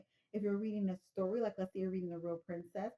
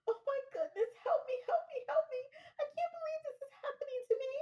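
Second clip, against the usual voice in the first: much higher, frantic caller begging for help; panicked caller. Acted. Caller begging the 911 operator for help, in a high frantic voice. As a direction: squeal for help like a hysterical lady.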